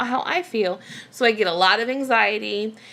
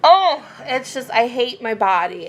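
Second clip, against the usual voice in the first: real exasperation; 4 semitones higher, 5 dB louder than usual. Real, not acted. Venting about her own body, exasperated. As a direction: burst out with a fed-up "oh".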